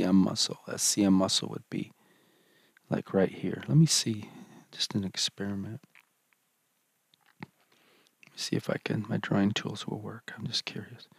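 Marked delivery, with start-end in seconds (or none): 3.8-11.1 soft whispered aside to self; whispering. Real, not acted. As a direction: whisper it under your breath. Aside while testing drawing tools.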